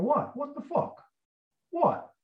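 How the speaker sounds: high-pitched, put-upon indignation; acted exasperation, pitched well above normal; irritated disbelief. Tony interrupted in the shower, high irritated retort.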